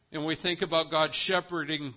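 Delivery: normal talking voice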